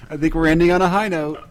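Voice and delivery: talking through laughter; laughing. Real, not acted. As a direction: say it while still laughing. Said while still laughing.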